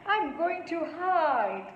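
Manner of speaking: put-on high, slow, shy child's voice; suddenly shy. A narrator's reading. Neetu about to hide from grandpa, suddenly shy. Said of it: "suddenly going all shy".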